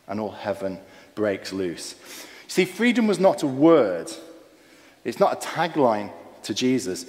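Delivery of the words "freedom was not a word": usual speaking voice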